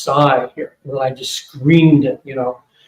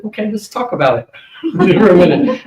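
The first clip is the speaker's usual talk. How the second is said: higher, louder, laughing as he backs away; a startled laugh, pitched up, pulling back; nervous laughing balk; 4 semitones higher, 5 dB louder than usual. Retold or imitated. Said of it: "I backed up a little".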